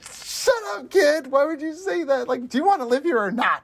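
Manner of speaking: high-pitched, hammed-up exasperation; comically frantic, very high voice; mock panicked parent. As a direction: scold the kid in a squeaky mock panic. Acts out panicked reaction to Sam.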